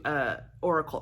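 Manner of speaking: ordinary talk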